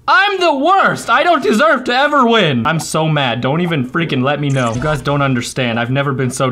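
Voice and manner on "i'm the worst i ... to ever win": anguished outburst. Acted. Player berating himself over a forgotten slide, anguished. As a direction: wail it like a melodramatic loser. Berating self after game mistake.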